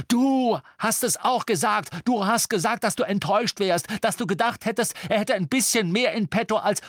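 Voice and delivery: heated, high-pitched accusing tirade; worked-up, indignant reproach; angry accusation. A narrator's reading. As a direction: throw it back at them angrily. Acted quarrel, accusing a friend.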